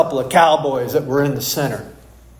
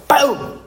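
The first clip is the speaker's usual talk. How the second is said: gunshot boom; 9 semitones higher than usual. Retold or imitated. Cowboys fire guns in story.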